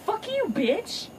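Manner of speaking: high, slow drawn-out diss; high mocking comeback, drawn long; sassy retort. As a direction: stretch it out with full attitude. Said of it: "She's like".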